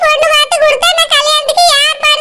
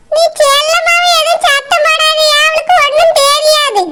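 ordinary talk, nothing marked

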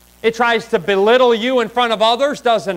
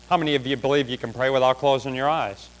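ordinary talk

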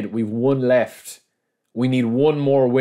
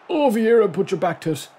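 high yell at a player; excited cry; 10 semitones higher than usual. Real, not acted. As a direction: cry out sharply in alarm at the play. Urging player in game.

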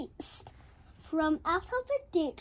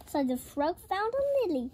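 plain reading voice

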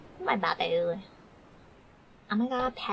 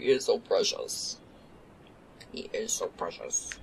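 much lower, breathy voice for the dog; low mock dog voice; 11 semitones lower than usual. Real, not acted. Doting on her dog, a low silly voice. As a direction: coo in a deep silly voice.

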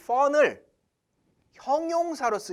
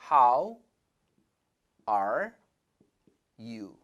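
slow demo; 8 semitones lower, much slower than usual. Shown as a demonstration. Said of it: "이렇게 바꾼다".

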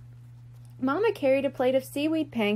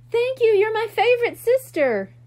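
much higher and louder, bursting with joy; delighted thanks; 11 semitones higher, 7 dB louder than usual. A narrator's reading. Shrimp thanks his sister for mailing the letter, excited and much higher. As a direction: squeal your thanks like an overjoyed kid.

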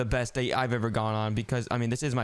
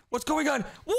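sudden high shout of alarm; startled shout; 12 semitones higher than usual. Acted. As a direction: yell it as the world vanishes. Sudden world switch, startled.

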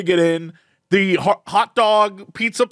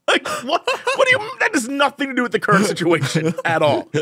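laughing disbelief; 6 semitones higher than usual. Real, not acted. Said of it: "laughing disbelief".

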